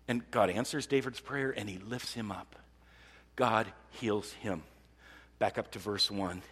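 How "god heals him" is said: ordinary talk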